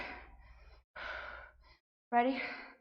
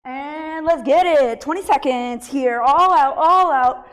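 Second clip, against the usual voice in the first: loud pumped-up coaching; hype coach shouting; 3 semitones higher, 10 dB louder, slower than usual. Real, not acted. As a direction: push them through the last seconds. Final all-out interval, coaching.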